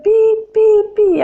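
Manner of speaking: high, loud beeping "pi"; imitated machine beeps. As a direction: mimic beeping hospital machines. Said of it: "máquinas que hacían ruido". (pitidos agudos de máquina imitados)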